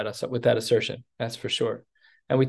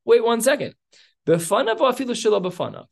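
higher, pointed gotcha question; gotcha challenge; 9 semitones higher, 3 dB louder than usual. Retold or imitated. The king springs his trap on the court, pointed and much higher. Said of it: "he leans in, he's like".

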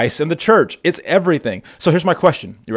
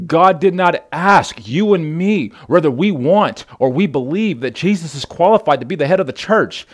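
normal talking voice

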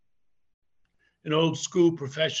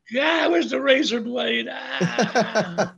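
loud, high-pitched comic outburst; mock despair; 5 semitones higher, 5 dB louder, slower than usual. Wrestler fed up with endless clotheslines, mock despairing shout. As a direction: cry out in exaggerated exasperation.

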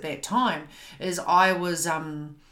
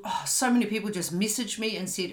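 ordinary talk, nothing marked

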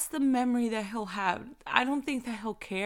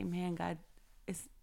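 soft prayer; 10 dB quieter than usual. Acted. Quiet wish spoken to God.

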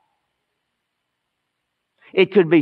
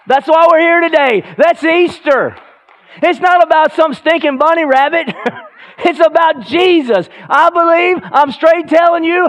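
higher and louder, building to a shouted Yes; full-force preaching; 12 semitones higher, 6 dB louder than usual. Acted. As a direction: preach it out with fire to the congregation.

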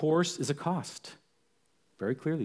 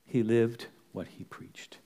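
slow, lowered, sober delivery; hushed solemn repeat; 4 semitones lower, slower than usual. Real, not acted. Preacher lets the apostle's example sink in, solemn and slow. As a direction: let it sink in, low and slow.

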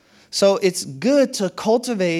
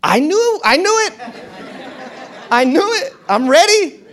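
triumphant told-you-so; 8 semitones higher, 5 dB louder than usual. Pretend. Expecting people to be annoying.

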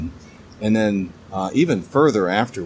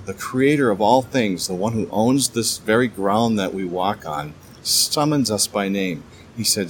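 ordinary talk

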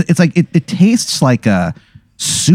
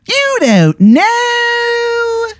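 put-on much higher, very slow, drawn out in Baltimore accent; bad Maryland accent; much slower than usual. Informant shocked the player lacks beer, Maryland accent. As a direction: stretch it out, amazed, in a Maryland accent. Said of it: "the worst Maryland accent".

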